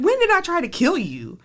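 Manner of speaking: high incredulous question; arrested woman answering the cop, incredulous; mock disbelief. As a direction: sound shocked at the accusation. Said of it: "I said".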